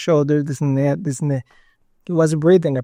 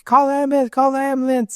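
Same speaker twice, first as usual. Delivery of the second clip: retold urgent cries from the crowd, pitched much higher; crowd calling out; 9 semitones higher than usual. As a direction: echo the bystanders yelling for help, higher up. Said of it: "you heard noises from the people like".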